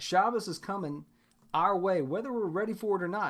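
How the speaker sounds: ordinary delivery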